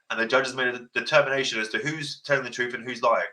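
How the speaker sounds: ordinary talk, nothing marked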